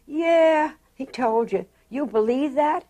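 high, loud mocking challenge; retold scoffing disbelief. Parents doubting their daughter's suitor's promise, scoffing disbelief.

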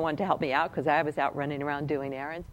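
ordinary delivery

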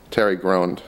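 ordinary delivery, nothing marked

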